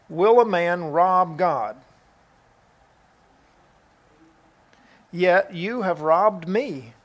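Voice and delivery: slow, weighty Bible reading; measured reading with long solemn pauses; scripture reading. Acted. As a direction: read the verse out deliberately, phrase by phrase. Reads a scripture verse aloud.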